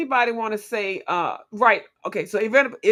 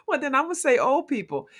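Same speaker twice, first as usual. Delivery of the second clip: ordinary talk, nothing marked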